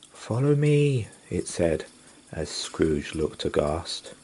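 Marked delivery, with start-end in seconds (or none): 0.1-1.1 higher, eerie summons; spirit beckoning. Acted. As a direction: call him to follow, otherworldly.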